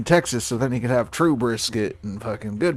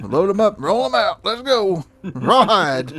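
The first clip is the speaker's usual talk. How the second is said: high, loud trail-boss call; cowboy cattle call; 9 semitones higher, 7 dB louder than usual. Pretend. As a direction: holler like driving cattle.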